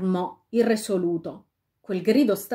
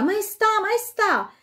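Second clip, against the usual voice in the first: high, drawn-out call from nowhere; eerie calling voice; 13 semitones higher, 4 dB louder, slower than usual. A narrator's reading. Unseen voice calling the king from behind. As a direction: tempt him to turn round, slowly. Mysterious voice calls to queen.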